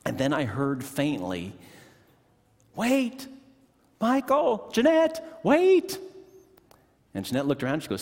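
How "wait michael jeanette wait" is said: imitating a far-off high call; much higher and louder, calling across a lot; an old lady hollering after a departing couple.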